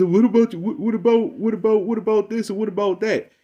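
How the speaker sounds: high, pestering "what about" voice; mocking whiny questioner. Mocking the man who keeps asking what about, lightly whiny. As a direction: mimic him nagging with a lightly whiny pitch. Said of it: "he goes".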